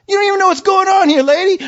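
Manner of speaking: fast, high-pitched indignant retort; heated back talk. Retold or imitated. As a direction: snap back at the officer, pitch up. Retold arguing back at officer.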